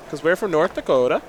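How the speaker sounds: usual speaking voice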